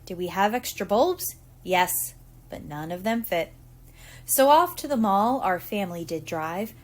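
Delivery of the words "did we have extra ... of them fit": ordinary delivery, nothing marked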